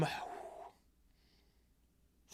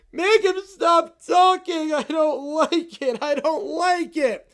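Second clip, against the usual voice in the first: put-on whiny kid; 6 semitones higher, 7 dB louder, much slower than usual. Kid whining at Vecna.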